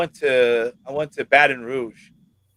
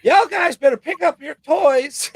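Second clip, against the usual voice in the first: high, put-on Southern wife calling out; hammed-up high-pitched scolding; imitated wife; 14 semitones higher than usual. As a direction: pitch it up like a wife telling kids off. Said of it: "my wife will be like".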